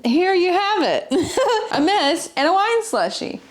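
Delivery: high, laughing through the words. Real, not acted. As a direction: giggle at the mess you made. Spilled slushie all over hand.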